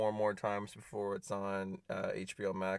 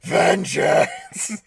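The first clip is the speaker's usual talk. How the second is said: shout; 12 semitones higher, 13 dB louder than usual. Acted. Shouting the movie's catchphrase.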